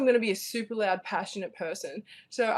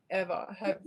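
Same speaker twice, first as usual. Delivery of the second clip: ordinary delivery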